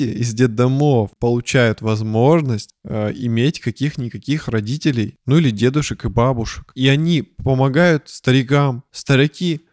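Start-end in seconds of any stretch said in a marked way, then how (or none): none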